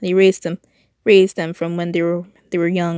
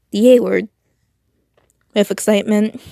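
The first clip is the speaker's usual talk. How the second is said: ordinary delivery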